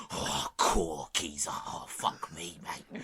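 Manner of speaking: hammed-up East End brawler; mock East End groan; East End geezer. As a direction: put on an East End pub geezer, dragged out. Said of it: "get into an East End pub brawl".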